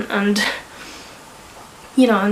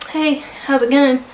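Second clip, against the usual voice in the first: cheery sister greeting; 6 semitones higher, 4 dB louder than usual. Retold or imitated. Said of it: "and she's like".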